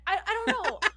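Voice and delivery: high, loud panicky outburst; panicked. Real, not acted. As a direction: blurt it out in a sudden panic. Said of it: "I just get paranoid".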